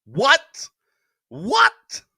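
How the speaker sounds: high, rising, loud "what?"; movie line impression. Quoting a favorite movie line to the show's audience, high loud impression. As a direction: toss out a movie catchphrase as a high, loud question. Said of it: "Best line in all of movie history".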